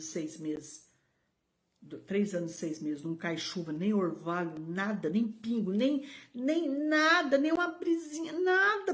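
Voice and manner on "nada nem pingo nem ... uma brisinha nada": climbing higher and higher to a peak on the last "nada"; emphatic build. Real, not acted. Preacher telling pastor no rain fell at all, emphatically building. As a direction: drive each negation further up in pitch. Stressing total absence of rain.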